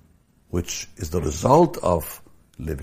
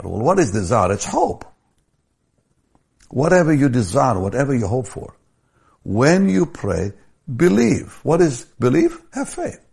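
high, loud, building sermon cry; preaching at full force; 5 semitones higher, 4 dB louder than usual. Acted. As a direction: drive the verse home to the crowd. Preaching climax, rising to full force.

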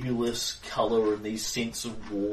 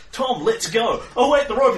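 excited shout; 11 semitones higher, 9 dB louder than usual. Acted. GM voices panicked characters.

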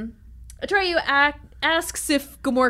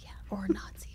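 whispering; 15 dB quieter than usual. Real, not acted. Joking aside.

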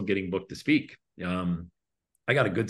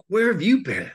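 high, excited, a bit louder, imitating Margene; eager woman's voice; 11 semitones higher, 6 dB louder than usual. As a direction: play the thrilled booker who just discovered him. Margene gushing after his talk.

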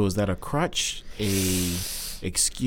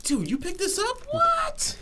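surprised; 4 dB quieter, slower than usual. Real, not acted. Delighted surprise at pin.

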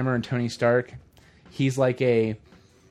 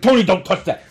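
louder scolding dad voice; loud mock fatherly warning; mock stern warning; 5 semitones higher, 10 dB louder than usual. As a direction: snap it like a nagging dad. Dad voiced scolding young Tony.

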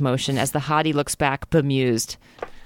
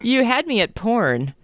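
higher, slow line with mild amusement; amused; 6 semitones higher, slower than usual. A narrator's reading. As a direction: let a touch of amusement colour it. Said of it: "Amusement colors his tone".

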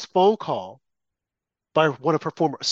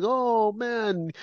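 drawn-out, put-on sympathy; mock pity; 5 semitones higher, 5 dB quieter than usual. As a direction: lay on fake violin-music pity. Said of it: "They're playing the violin".

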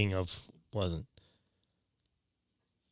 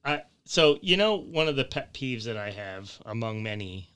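usual speaking voice

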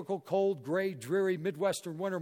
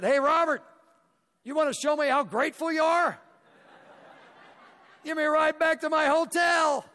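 much higher, louder, calling out the favour; playful loud ask; 12 semitones higher, 8 dB louder than usual. Retold or imitated. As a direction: shout the request with a grin. Speaker asks Robert for ride.

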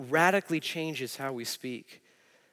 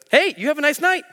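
much higher, louder sing-song cop; imitated cheery cop; 12 semitones higher, 7 dB louder than usual. Officer dismissing the nurse, hammed-up cheery cop. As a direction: voice the cop letting him go breezily.

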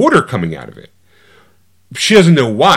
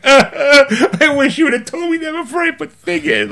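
much higher, laughing through a hammed-up sob; mock crying. The crying inspector whining to the heroine, mock sobbing. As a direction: whimper it like a big baby, cracking up. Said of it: "So he starts crying".

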